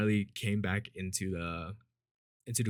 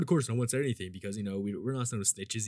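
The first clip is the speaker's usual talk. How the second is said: normal talking voice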